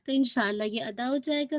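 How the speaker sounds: ordinary delivery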